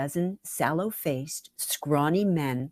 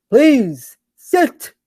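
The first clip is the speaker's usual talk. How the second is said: higher, louder host greeting; hearty welcome; 7 semitones higher, 12 dB louder than usual. Acted. Marty inviting Lisa to sit, louder and higher. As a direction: welcome her in warmly, big and open.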